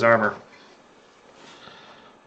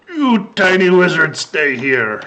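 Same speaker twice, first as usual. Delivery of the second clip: louder ogre voice, starting high and dropping; 5 semitones higher, 5 dB louder, slower than usual. Acted. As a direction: order the tiny foe like an ogre. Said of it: "looks down at Haraka and goes".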